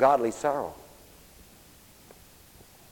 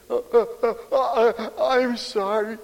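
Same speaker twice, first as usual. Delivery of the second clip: put-on higher, halting little-kid catch-breath voice with a laugh; sobbing child; 9 semitones higher, slower than usual. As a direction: imitate a tot gulping out an apology. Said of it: "they catch their breath and they say".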